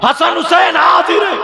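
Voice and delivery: high, loud proclamation; fiery preaching cry. Acted. Preacher declaring Hasan and Husain present, loud. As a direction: proclaim it to the crowd.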